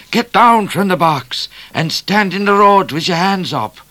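high, loud bushranger commands; called-out orders. A narrator's reading. Bushranger ordering Midnight from the box, loud and high. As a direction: order the driver down. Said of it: "a voice called from the bush".

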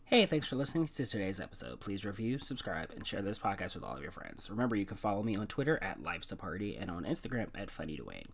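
announcer-style outro read; podcast outro. Acted. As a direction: read it like a sign-off.

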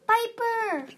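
high, loud call of a name; anguished cry. A narrator's reading. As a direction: cry out high for a lost companion. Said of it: "Fate Speaker cried".

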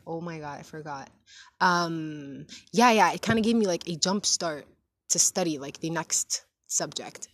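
ordinary talk

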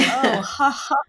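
mock awkward "oh, ha ha"; uncomfortable laugh. Neighbors react to the text, mock uncomfortable laugh. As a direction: imitate a forced, uneasy laugh. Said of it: "laughed uncomfortably".

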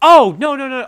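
real startled outburst, loud and high; sudden realization. Real, not acted. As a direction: burst out as your mistake dawns on you. Suddenly realizes own mistake.

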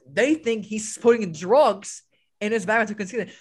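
ordinary talk, nothing marked

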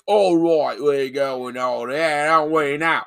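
loud, drawn-out mock British accent; exaggerated British accent. Co-host mocking actors who dropped their American accent, hammed-up British accent. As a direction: lay the British accent on thick. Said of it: "other actors just gave up halfway through".

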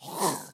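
short guttural French-R rasp; throaty hacking noise. Shown as a demonstration. Bartender mocking the French sound, throaty hacking noise. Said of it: "Don't put that".

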